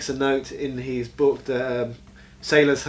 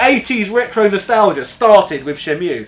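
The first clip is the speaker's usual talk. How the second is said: higher, louder retro enthusiasm; excited; 6 semitones higher, 10 dB louder than usual. Real, not acted. Gamer defending his nostalgia favourite, excited. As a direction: gush loudly, pitch lifted. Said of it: "you're really excited about this".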